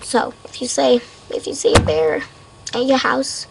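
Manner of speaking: usual speaking voice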